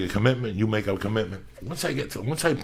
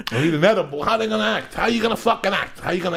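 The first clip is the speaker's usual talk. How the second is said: louder, higher mock sermon; preachy rant; 10 semitones higher, 4 dB louder than usual. Real, not acted. Preaching about setting an example.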